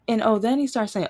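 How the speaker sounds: ordinary talk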